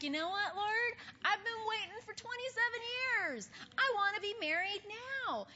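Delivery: a single believer nagging God, high and loud; impatient plea to God. Retold or imitated. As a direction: whine at the Lord that the wait is too long. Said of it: "It's like".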